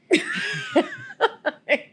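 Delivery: high squealing laugh; shrieking laugh; burst of laughter. Real, not acted. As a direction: cracks up at the memory. Laughing at gum prank.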